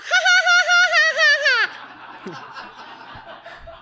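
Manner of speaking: squeaky puppet giggle, very high; high Elmo giggle. Pretend. Laughing as Elmo for the kids, very high. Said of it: "my name is Elmo".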